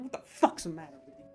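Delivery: ordinary delivery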